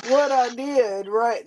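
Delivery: ordinary talk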